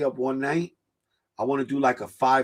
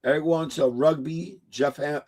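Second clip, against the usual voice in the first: ordinary talk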